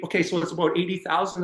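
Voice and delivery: usual speaking voice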